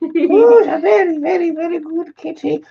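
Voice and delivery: high, cooing pet voice. Real, not acted. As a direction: coo up high at the kitty like a doting owner. Talking to the cat.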